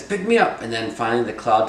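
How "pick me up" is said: very high, loud plea; desperate call. Acted. Winnie Bijou begging the bird to rescue him, high loud cry. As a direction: shout it up as the cloud fades. Trickster begs bird from cloud.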